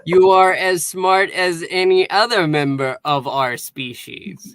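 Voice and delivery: stiff recital in put-on alien voice; imitated alien compliment. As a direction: deliver the compliment word by word, formally. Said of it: "He said something like".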